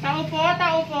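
loud call toward the house; calling from far away. Real, not acted. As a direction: call out to whoever's inside.

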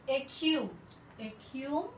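écume with a hard K, ay-KUM; French pronunciation. Shown as a demonstration. Teacher demonstrates écume for the class, a clear K. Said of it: "so it will be K".

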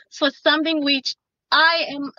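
normal talking voice

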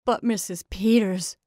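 loud, urgent cry. A narrator's reading. Farm wife urges the sheriff's wife to look, crying out. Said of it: "cried Missus Hale".

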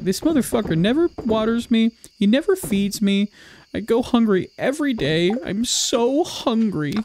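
a hungry plant moaning to its owner, pitiful and high; whiny plant voice. Retold or imitated. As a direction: whine like a starving little plant. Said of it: "what I imagine he sounds like".

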